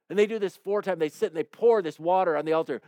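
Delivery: normal talking voice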